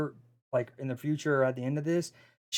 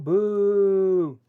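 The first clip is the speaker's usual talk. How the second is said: playful boo; 6 semitones higher, 4 dB louder than usual. Acted. Mock booing an ad break.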